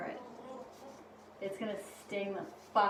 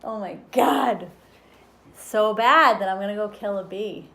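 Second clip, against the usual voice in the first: distressed outburst; 13 dB louder than usual. Real, not acted. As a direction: burst out in real dismay. Worked up about killing bee.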